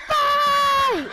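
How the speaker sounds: high, loud, drawn-out yell; long shouted rallying cry; scream. Retold or imitated. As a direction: scream it across a whole restaurant.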